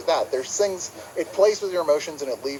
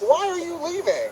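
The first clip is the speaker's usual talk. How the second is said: high, pleading whine; whiny plea. Retold or imitated. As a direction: plead like a hurt fan. Mocking upset viewer.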